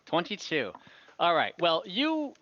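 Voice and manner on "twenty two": ordinary delivery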